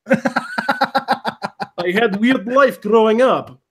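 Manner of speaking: put-on deadpan joke in Russian accent. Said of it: "I can do a Russian accent".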